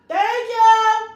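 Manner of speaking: high, loud, pointed thank you; loud mock-grateful call; sarcastic yell. Real, not acted. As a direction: call it out with attitude. Sarcastic thanks yelled to kids.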